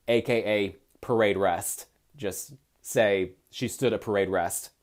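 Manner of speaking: ordinary talk, nothing marked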